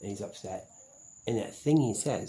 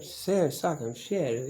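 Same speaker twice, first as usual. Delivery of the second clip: mocking the guy; 6 semitones higher, 5 dB louder, slower than usual. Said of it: "the guy said".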